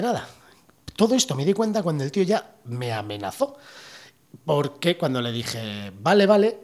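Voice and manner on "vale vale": anxious placating. Retold or imitated. Placating the angry cop.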